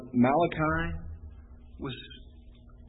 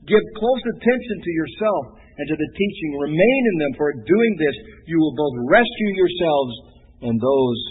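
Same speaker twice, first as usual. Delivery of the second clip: louder preached charge; preached scripture; 2 semitones higher, 7 dB louder than usual. Acted. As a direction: urge the listener firmly.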